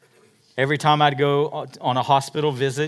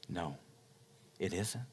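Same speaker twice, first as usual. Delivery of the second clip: ordinary delivery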